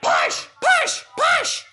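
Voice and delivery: loud squeaky cartoon cries; urging shouts. A narrator's reading. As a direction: shout it in short bursts to goad him. Cars egg Diesel on to push.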